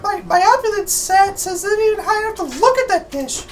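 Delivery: very high, surprised character voice. Acted. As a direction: squeak it out in surprise. Character voice in game scene.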